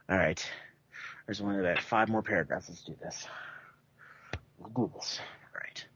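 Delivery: hushed aside under the breath; quiet, half-whispered pep talk; whispering. Real, not acted. Quiet aside before reading on.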